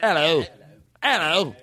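mock Cockney greeting; Cockney accent. Hosts echoing the guest's hello, mock Cockney. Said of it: "that Cockney guy".

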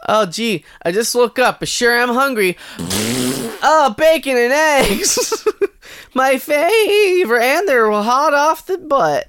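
high, slow, cheery voice; sing-song happy morning kid; chipper morning voice. Acted. Acting waking person wanting breakfast.

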